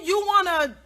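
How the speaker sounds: ordinary talk, nothing marked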